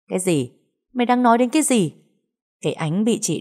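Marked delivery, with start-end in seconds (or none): 0.1-1.9 higher, quickening indignant question; mild narrated yell. A narrator's reading. Slapped younger sister demands an explanation, mild indignant yell. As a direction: snap back indignantly without really shouting. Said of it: "Nó gào lên hỏi".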